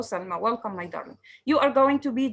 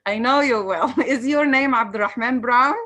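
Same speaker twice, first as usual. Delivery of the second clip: amused, louder, laughing as you speak; 6 dB louder than usual. Real, not acted. Teacher stopping Selma over a name mix-up, laughing. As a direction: talk through bursts of laughter.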